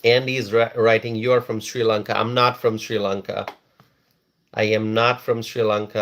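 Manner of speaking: usual speaking voice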